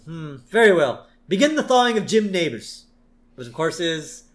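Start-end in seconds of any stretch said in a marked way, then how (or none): 0.1-2.8 higher, louder villainous boss; Burns. Retold or imitated. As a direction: issue a grand command as a mock tycoon. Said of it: "And Burns says".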